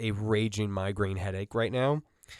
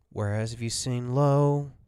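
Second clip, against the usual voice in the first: usual speaking voice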